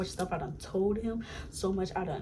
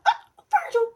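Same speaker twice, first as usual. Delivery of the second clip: much higher, loud delighted recognition; excited, startled greeting; surprised; 11 dB louder than usual. Retold or imitated. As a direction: squeal his name in surprise. Surprised he calls her.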